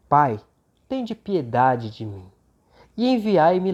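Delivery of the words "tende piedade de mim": normal talking voice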